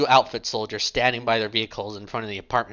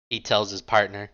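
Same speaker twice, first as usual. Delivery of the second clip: ordinary delivery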